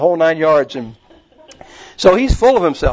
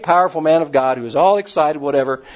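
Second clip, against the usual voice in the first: ordinary talk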